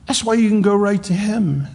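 high, ringing declaration; fervent preaching. Real, not acted. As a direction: proclaim it with lifted fervor. Preacher stays at fervent peak.

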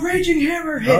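battle cry. Acted. Calling out attack name.

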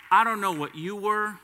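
ordinary talk, nothing marked

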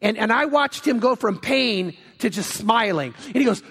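ordinary talk

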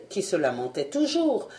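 ordinary delivery, nothing marked

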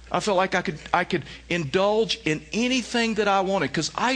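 high, impassioned preacher's cry, slowing on the key words; preaching at full force. Acted. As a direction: preach it to the back row, drawing out the warning. Preaching climax, Tiger Woods confession.

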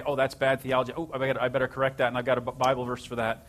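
fast, eager rush to correct; a fast, keen self-mockery; eager corrector. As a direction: hurry like the know-it-all helper. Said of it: "so wanted to correct their theology".

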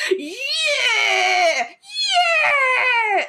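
very high whooping yells; drunken cheer. Retold or imitated. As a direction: whoop like a drunk at a rock show. Drunk guy cheering at takeoff.

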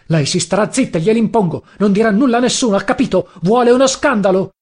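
exasperated shout. A narrator's reading. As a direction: lay down the law, pitch climbing. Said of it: "Esasperato le gridai".

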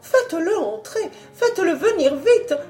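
eager excitement. A narrator's reading. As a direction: gush with relief and hope. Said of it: "s'exclama la baronne Papillon".

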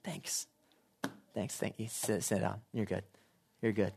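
imitating a shy, modest God; modest quiet thanks. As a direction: wave off the praise, hushed and modest. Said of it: "he'll go".

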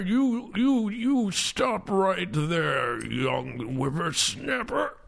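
put-on very slow, wheezy old man; Wilfred Brimley. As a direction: drag it out like a breathless old man. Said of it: "more like a Wilfred Brimley, but more out of breath".

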